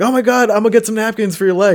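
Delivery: high, breathless offer of napkins; mock fussy girl. Imitating the driver offering napkins, high and flustered. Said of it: "she's like".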